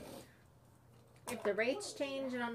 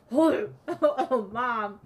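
laughing exclamation; 10 dB louder than usual. Retold or imitated. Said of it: "she goes".

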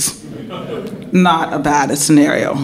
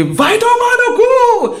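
Vai tomar no cu! much higher, slow, half laughing; correct way to yell it; slower than usual. Shown as a demonstration. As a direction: belt it high like a true fan. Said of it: "No, no, no, Don".

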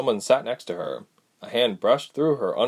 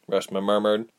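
plain reading voice

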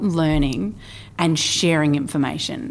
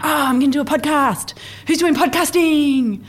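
excited; 10 semitones higher, 4 dB louder than usual. Retold or imitated. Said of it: "came back to Adelaide and went".

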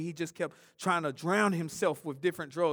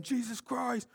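high excited cry of praise; imitating a joyful shout to God; yelled praise; 6 semitones higher, slower than usual. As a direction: call it out like a street preacher. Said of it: "always yelling".

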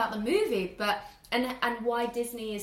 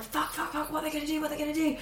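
higher, urgent panicked muttering; anxious swearing; 5 semitones higher, faster than usual. Retold or imitated. Friend retelling her panic watching a chase scene, urgent swearing. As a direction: fret at the screen in suspense. Retelling own tense reaction to film.

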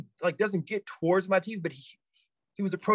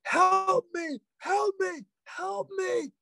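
very high, wailing mock scream; high, drawn-out plea; imitated screaming; 13 semitones higher, slower than usual. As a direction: imitate bawling for help at reduced volume. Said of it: "just, just screaming".

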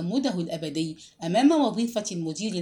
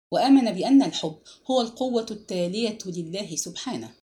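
ordinary delivery, nothing marked

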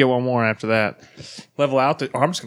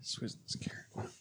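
whisper; 23 dB quieter than usual. Real, not acted. Aside while eating hot wings.

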